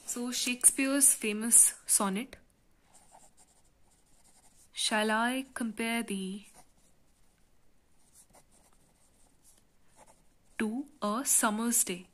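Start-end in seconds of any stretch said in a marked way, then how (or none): 4.7-12.1 measured, broken-up line of poetry. Shown as a demonstration. Reads sonnet line for scansion.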